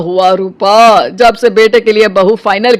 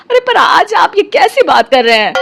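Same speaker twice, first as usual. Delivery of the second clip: higher, voice close to crying; tearful protest; 7 semitones higher than usual. Acted. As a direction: protest through rising tears. Shocked wife near tears. (रुआँसी आवाज़ में ऊँचे सुर से विरोध)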